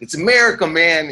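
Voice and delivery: high, pushy American boast; mock patriotic yell. Comic imitates American at the border, high mock yell. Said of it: "don't have to yell at me".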